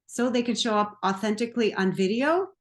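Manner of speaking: upspeak, "video" climbing like a question; ending on an up note. Shown as a demonstration. Coach demonstrates client's unsure marketing pitch, rising on "video". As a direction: turn the statement into a question by lifting "video". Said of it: "I'm trying to do it".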